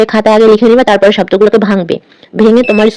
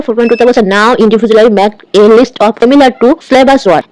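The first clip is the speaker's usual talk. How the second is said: ordinary delivery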